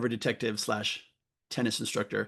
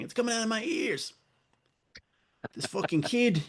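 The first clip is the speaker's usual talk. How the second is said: put-on much higher, a joking Boston accent; mock Boston accent; 10 semitones higher than usual. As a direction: fake a Boston accent, half-failing. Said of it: "Or is that a Jersey accent?".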